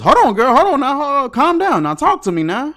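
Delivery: high, loud hammed-up calming; mock soothing cop. Imitating Marcus hammily calming an already calm Julie. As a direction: overdo the frantic calm-down. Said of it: "He says".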